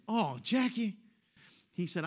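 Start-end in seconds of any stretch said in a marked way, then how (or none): 0.1-1.0 high North Carolina holler, imitated; North Carolina accent. Husband calling his wife in the car, mock North Carolina accent. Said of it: "North Carolina accent".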